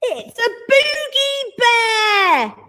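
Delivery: high, slow gleeful shout. A narrator's reading. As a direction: announce it, amazed. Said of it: "shouted the littlest one".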